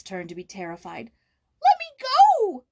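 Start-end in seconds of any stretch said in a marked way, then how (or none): 1.6-2.6 loud frightened shriek. A narrator's reading. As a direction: shriek to break free. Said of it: "she shrieked in English".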